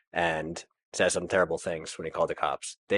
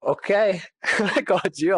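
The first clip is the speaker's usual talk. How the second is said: laughing relief; 11 semitones higher, 5 dB louder, slower than usual. Real, not acted. Relief after the night.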